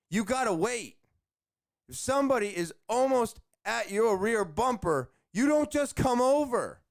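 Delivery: high-pitched, emphatic telling-off; exasperated lecture, pitched well up; scolding bad drivers. Real, not acted. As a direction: lay down the rule to a careless driver. Scolding drivers who cut in.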